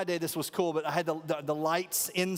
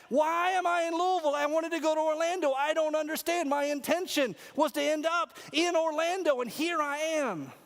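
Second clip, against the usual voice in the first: upset complaining; 8 semitones higher than usual. Retold or imitated. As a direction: whine it like a lost, furious tourist.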